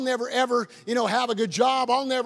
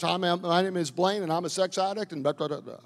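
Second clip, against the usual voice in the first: quiet, lower, resigned confession; old defeated check-in; 4 semitones lower, 5 dB quieter than usual. Retold or imitated. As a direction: mumble it like a beaten man. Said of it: "don't you check in like that ever again".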